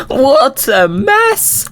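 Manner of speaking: high, drawn-out laughing exclamation. Real, not acted. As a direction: laugh in delighted disbelief. Finding the pranked base.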